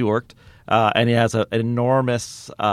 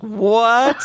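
high, incredulous yelp; Stephen Merchant; 11 semitones higher, 3 dB louder than usual. Retold or imitated. Comedian reacts to an awful improv line, aghast. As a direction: imitate stunned disbelief, pitched way up.

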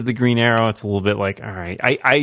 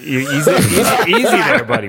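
mock soothing; 3 semitones higher, 3 dB louder than usual. Real, not acted. Calming the outburst.

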